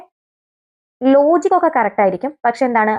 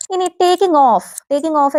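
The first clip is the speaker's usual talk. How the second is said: raised announcing; 5 semitones higher than usual. Shown as a demonstration. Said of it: "teacher announces next option".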